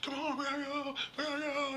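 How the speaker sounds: put-on dragging his girlfriend away in a panic; urgent fleeing. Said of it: "he's like".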